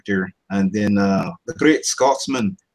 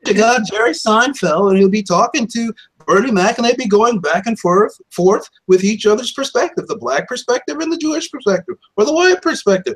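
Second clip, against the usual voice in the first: higher, louder, nasal-free sitcom riff voice; Jerry Seinfeld; 13 semitones higher, 3 dB louder than usual. Acted. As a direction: riff like an observational comic setting up a bit. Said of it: "I can do Jerry Seinfeld".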